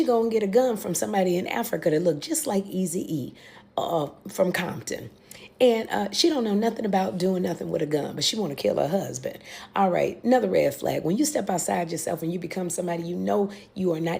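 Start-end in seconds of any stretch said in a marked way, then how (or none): none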